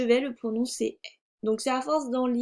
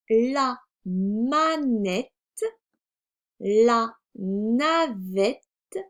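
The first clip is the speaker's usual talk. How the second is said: very slow, syllable by syllable; reading words aloud; 3 dB louder, much slower than usual. Shown as a demonstration. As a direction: stretch and separate each syllable for beginners. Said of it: "nous allons lire les mots". (lecture très lente, syllabe par syllabe)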